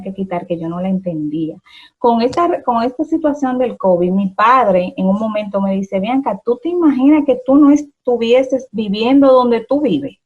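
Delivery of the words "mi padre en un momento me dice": ordinary talk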